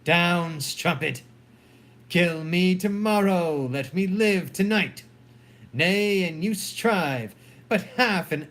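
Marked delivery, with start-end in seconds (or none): none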